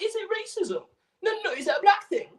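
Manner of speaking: squeaky, very high-pitched mock voice like he swallowed a mouse; mouse-voiced parody of a footballer; mocking Sol Campbell voice. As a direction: pitch way up and squeeze it, mocking him. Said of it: "Why the fuck does he sound like that?".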